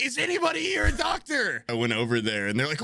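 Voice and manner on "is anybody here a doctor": high urgent call. Retold or imitated. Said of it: "the stewardess being like".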